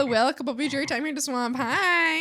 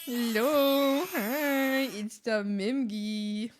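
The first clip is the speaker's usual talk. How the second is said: slow drawl of the name; drawn-out; much slower than usual. Pretend. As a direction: stretch every word. Drawn-out repetition.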